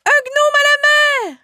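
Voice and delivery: high, loud alarmed cry; shout. Acted. Boy spotting the gnome overboard, shouting in alarm. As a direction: yell it out in panic across the deck. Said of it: "il entendit hurler".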